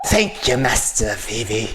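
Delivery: overly intimate mock thanks; creepy knight. Knight thanks the young mage, unintentionally creepy. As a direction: thank them with a creepy lilt. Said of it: "I think I made him sound like, uh, pedo".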